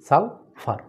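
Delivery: Sulphur broken in two with a pause, সাল, ফার; teacher counting two syllables in sulphur; split into syllables. Shown as a demonstration. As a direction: stop between the halves of sulphur. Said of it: "ডাইসিলেবল".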